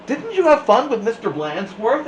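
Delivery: high, sweet innocent teacher. A narrator's reading. Nelson voiced sweetly.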